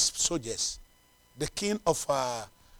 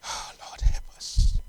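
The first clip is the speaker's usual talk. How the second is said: whispered plea; slower than usual. Real, not acted. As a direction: breath-only whisper to God. Sighed plea opening a prayer.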